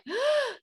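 sharp, high imitated gasp; shocked gasp. Coach acting out a client's shock, a high sharp gasp. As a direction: gasp as if the rug was pulled out. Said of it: "And then you're like".

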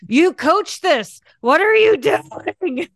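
high, drawn-out exasperated scolding with a laugh; mock self-scolding. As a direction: scold yourself in hammed-up disbelief, slowly. Acts out scolding herself.

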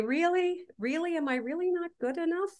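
high, searching self-questioning; gently questioning. Retold or imitated. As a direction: ask yourself in a lifted, doubting tone. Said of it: "to challenge those core beliefs and to say".

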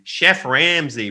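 drawn-out, imitated American accent; mock American accent. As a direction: put on an American accent for the title. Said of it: "apologize for my American accent".

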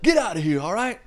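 high, loud mock rapper yell; imitated scream. [name] shutting the fight down, loud mock scream. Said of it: "just the way he screams".

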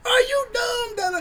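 high, barked mock yell; yelling instructor. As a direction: imitate a drill instructor screaming at a recruit. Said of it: "my C C is fussing and fussing at me and cussing".

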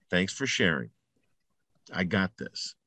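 quiet and calm, low, soft-spoken; calm dismissal. Shown as a demonstration. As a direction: brush the voice off gently, under your breath. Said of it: "those are the most important words".

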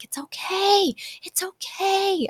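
a gentle mock hush; hushing reassurance. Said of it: "I am guilty of saying that".

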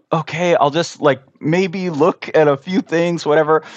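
imitating the stranger's reluctant shrug; hesitant lady. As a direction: play her unsure and going along with it.

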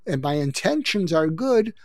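usual speaking voice

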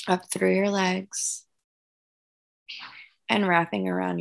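calm guided breathing voice. Acted. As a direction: gently lead the breathing. Leads guided meditation visualization.

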